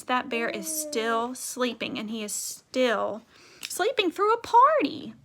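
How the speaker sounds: plain reading voice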